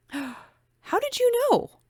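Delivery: high breathless gasp of surprise; gasped question. A narrator's reading. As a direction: catch your breath and blurt it out. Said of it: "Becky gasped".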